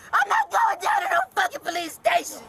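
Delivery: high furious scream; shouting. Real, not acted. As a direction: yell it out in a rage. Screaming refusal at officers.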